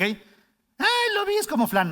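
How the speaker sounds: high, squealing mock voice of a doubter; mock listener. Mocking a churchgoer who calls it flan in a high voice. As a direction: parody someone who thinks it's flan.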